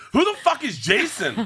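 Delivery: high, drawn-out comic disbelief; slow, high-pitched incredulous demand; mock bewildered outburst. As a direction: imitate boys blurting out in total confusion. Acting out boys' baffled outburst.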